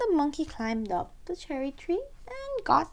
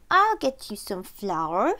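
a lightly lowered, slow bear voice; 6 semitones lower, 5 dB louder, slower than usual. Acted. Bear offering flour to the child, slow and low. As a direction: lumber through it like a gentle bear. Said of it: "said the bear".

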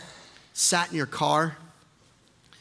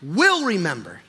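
high, ringing declarations of resolve; full-force preaching; 8 semitones higher, 4 dB louder than usual. Acted. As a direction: proclaim it to the whole church, voice raised.

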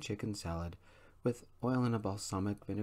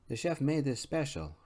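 cheerful waitress; 6 semitones higher, 4 dB louder than usual. Acted. Waitress voiced serving dessert.

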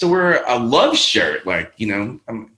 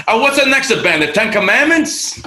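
put-on much higher, louder Italian-accented father; Enzo's Italian accent; 11 semitones higher, 4 dB louder than usual. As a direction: tease the son in a dad's Italian accent. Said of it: "With the Italian accent".

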